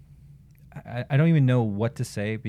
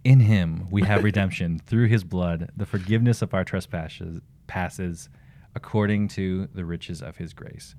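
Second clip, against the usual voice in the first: ordinary delivery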